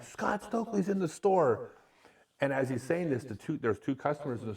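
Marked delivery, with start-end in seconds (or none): 0.0-1.7 high, excited call to the back room; excited announcement. Retold or imitated. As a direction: yell it to everyone in back. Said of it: "I hear him in the back".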